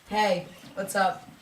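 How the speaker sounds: ordinary delivery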